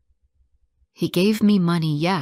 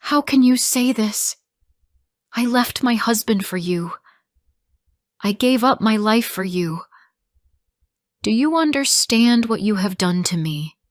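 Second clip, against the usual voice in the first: higher, louder anguished outcry; pleading accusation, pitched up and falling away; crying out; 5 semitones higher, 5 dB louder than usual. A narrator's reading. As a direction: cry out in hurt disbelief. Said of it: "I cried out".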